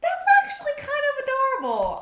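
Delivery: very high-pitched cooing; tiny high voice, charmed; gushing squeal. Real, not acted. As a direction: squeak it out at something adorable. Gushing over cute character.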